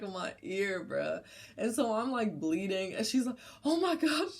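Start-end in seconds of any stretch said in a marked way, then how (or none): 3.6-4.3 high, louder mock panic; panicked retold exclamation, pitched high; imitated freak-out. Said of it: "she's, like, freaking out".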